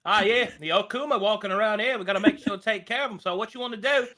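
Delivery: hammed-up Aussie giving travel tips; fast Australian bloke warning mates; Aussie accent. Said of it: "will just become Australian".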